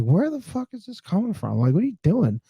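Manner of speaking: high, startled what-the-hell; shocked outburst. Retold or imitated. To the drunk wives doing shots, shocked. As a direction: recoil in disbelief, voice jumping up. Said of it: "I'm like".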